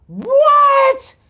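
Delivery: very high-pitched disbelief; incredulous. Acted. Host marvels at Chad Ochocinco, incredulous squeal. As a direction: pitch it way up, amazed.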